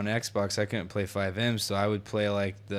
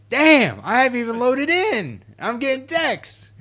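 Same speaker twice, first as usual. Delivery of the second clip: much higher, louder exasperated complaint; exasperated whine; 13 semitones higher, 8 dB louder than usual. Retold or imitated. Gamer flooded with texts on login, high exasperated whine. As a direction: whine it like you can't believe it.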